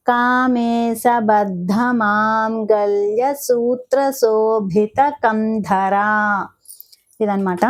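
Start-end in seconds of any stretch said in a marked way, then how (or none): 0.1-5.9 the whole name drawn out, ధర lengthened at the end; slow careful recitation. Shown as a demonstration. Teacher modelling the full name for students, slow. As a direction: recite it slowly, stretching the last syllable. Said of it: "ఎలా practice చేసుకుంటున్నానో మీకు మాత్రం చూపిస్తున్నాను".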